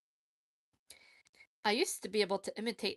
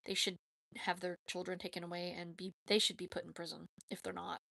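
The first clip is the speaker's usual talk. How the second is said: usual speaking voice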